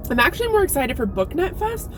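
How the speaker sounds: ordinary talk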